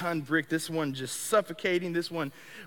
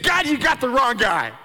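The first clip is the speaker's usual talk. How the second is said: louder, higher exclamation; protest to God; 6 semitones higher, 8 dB louder than usual. Retold or imitated. Jumping off the bed, protesting loudly to God. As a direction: blurt it out in disbelief. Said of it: "I said".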